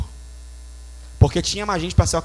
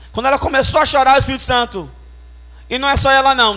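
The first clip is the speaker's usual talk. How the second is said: louder, faster and higher; excited; 5 semitones higher, 5 dB louder, faster than usual. Real, not acted. Preacher excitedly recounting the outbreak in the church. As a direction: rush it with growing excitement.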